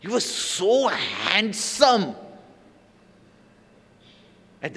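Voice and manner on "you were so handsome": very slow, drawn-out praise; mild swooning compliment; slow admiring compliment. Retold or imitated. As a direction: draw it out admiringly. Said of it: "she says".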